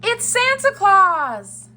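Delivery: high, loud, drawn-out cry of discovery; Baby Bear. A narrator's reading. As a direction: cry out slowly like an excited cub. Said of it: "cried Baby Bear".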